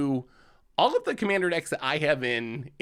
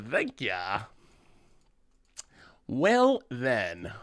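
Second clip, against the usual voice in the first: normal talking voice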